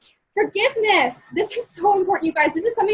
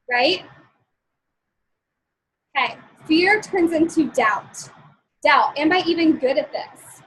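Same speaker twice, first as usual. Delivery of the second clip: normal talking voice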